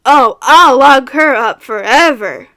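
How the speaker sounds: loud, swaggering character voice with swooping pitch; Rye Cookie. Shown as a demonstration. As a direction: drawl it out big and bold. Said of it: "Rye Cookie".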